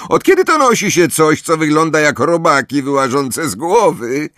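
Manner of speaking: high-pitched heckling; jeering shriek. A narrator's reading. Parkinson mocking Johnson's hair, shrieked high. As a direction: keep the taunt high and carrying. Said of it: "wrzasnęła z dołu pensji Parkinson".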